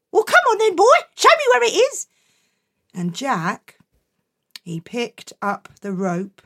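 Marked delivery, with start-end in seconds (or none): 0.1-2.1 high, quick impatient mother; impatient mum. Acted. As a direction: hurry him along.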